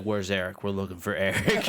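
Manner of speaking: low-pitched, lightly voiced heavy; deadpan low demand, put on; mock intimidator. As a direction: play a mild tough guy at the door. Coaching an intimidating door act.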